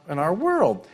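ordinary talk

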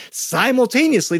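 "Simultaneously" pitched high with a falling end; playful high emphasis on "Simultaneously"; repeated story word. Shown as a demonstration. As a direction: sing out "Simultaneously" for young listeners. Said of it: "I use that word ten more times in the story".

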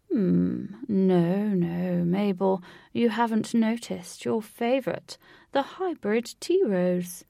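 put-on fond elderly husband, unhurried; genteel old gentleman. A narrator's reading. Old husband to his wife among roses, gently correcting.

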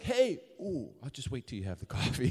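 a touch of wary, teasing voice; backing off playfully from a grumpy friend; mock cautious greeting. Greeting someone without coffee.

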